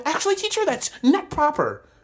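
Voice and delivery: high-pitched pedantic classroom pipe-up; mock a prissy student correcting the teacher; know-it-all student.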